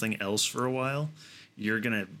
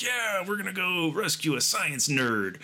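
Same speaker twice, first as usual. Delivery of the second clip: mock gamer boast; 8 semitones higher than usual. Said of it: "We're like".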